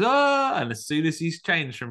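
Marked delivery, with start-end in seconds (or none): 0.0-0.6 high, loud startled yelp. Retold or imitated. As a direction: yelp like a man caught by a fright. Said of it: "and he goes".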